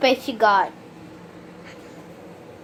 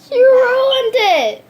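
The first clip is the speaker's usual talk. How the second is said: high, slow, laughing disbelief; shocked laughing outburst; 10 semitones higher, 4 dB louder, slower than usual. Real, not acted. Sister ruins the slime.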